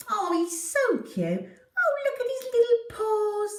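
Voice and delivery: high gushing coo over a kitten; cooing family. Acted. Family fussing over the new kitten, high cooing. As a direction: gush like they've seen something adorable. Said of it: "They were all saying things like".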